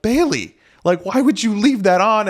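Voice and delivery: pitched high, mock-exasperated scolding of his wife. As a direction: act out the big deal over a candle, voice up high. Husband scolding wife over candle.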